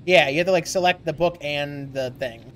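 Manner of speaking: normal talking voice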